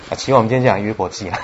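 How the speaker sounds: usual speaking voice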